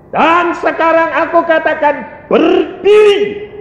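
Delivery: high, loud order with a drawn-out last word; booming divine command. Retold or imitated. God ordering the kneeling believer to stand, loud and commanding. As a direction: raise the voice and command with authority.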